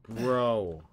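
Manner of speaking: loud frustrated shout; exasperated shout. Real, not acted. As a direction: yell in frustration. Exasperated after falling.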